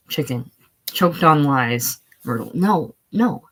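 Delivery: usual speaking voice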